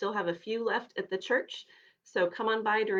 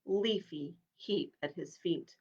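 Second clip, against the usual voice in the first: ordinary delivery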